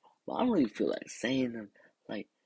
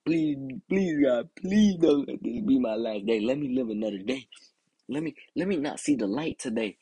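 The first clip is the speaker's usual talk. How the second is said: retold desperate prayer; 2 semitones higher than usual. Little kid praying to God, mock-desperate plea. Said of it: "was I a little kid praying to God".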